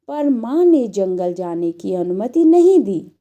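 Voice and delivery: ordinary talk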